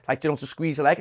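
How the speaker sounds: ordinary talk, nothing marked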